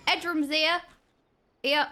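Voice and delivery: ordinary delivery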